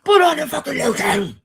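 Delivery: high, fast, loud shout; shouted movie line. Retold or imitated. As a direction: bark the order like the movie villain. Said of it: "thinking of Silence of the Lambs".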